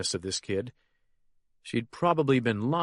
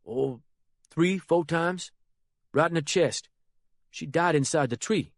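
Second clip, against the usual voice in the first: put-on a lightly higher, nervous young man's voice; young Harold's voice; 6 semitones higher than usual. The boy reporting the killing to the Colonel, a lightly raised nervous voice.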